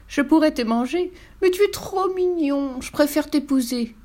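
high-pitched, lingering flirty coo; cooing yeti damsel. A narrator's reading. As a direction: sweet-talk a captive suitor in a high voice. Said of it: "roucoula Damoiselle Yeti". (roucouler amoureusement, voix haut perchée)

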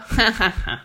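mocking acted snicker; witch's cackle. A narrator's reading. Witch laughing at the little ogre, cackling. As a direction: cackle at your helpless prey. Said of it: "ricana la sorcière".

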